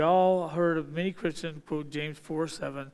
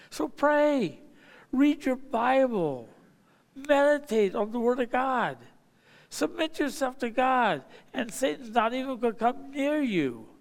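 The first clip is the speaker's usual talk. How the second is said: high-pitched, emphatic urging; preaching exhortation; 6 semitones higher than usual. Real, not acted. Preacher urging people to pray and read, emphatic exhortation. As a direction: drive each command home, pitched up high.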